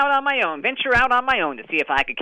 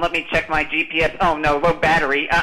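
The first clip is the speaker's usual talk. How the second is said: usual speaking voice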